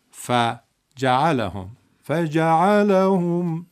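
فجعلهم drawn out very slowly; teacher slowly modelling the word; slow model recitation. Shown as a demonstration. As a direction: stretch فجعلهم syllable by syllable. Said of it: "ف رو نبر بالا".

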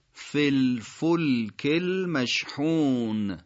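فی الفلک المشحون slowly joined, with a short "fil"; three words linked. Shown as a demonstration. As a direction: link فی الفلک المشحون syllable by syllable, drawn out. (فی الفلک المشحون شمرده و متصل، با «فِ» کوتاه)

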